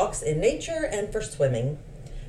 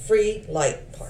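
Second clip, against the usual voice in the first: slow translation; 3 semitones higher, slower than usual. Shown as a demonstration. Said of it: "Which means".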